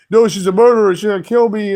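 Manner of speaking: mock-frightened protest. Driver arguing she is dangerous, mock fear. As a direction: insist in put-on fear.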